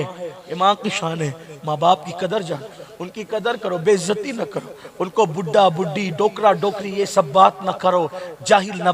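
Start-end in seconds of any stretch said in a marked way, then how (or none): none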